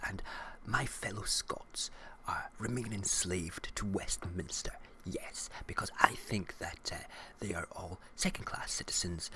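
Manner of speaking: sneering whispered politician confession; mock Gove whisper. Vlogger mocking Michael Gove in a hushed whisper. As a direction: whisper it like a smug minister spilling secrets.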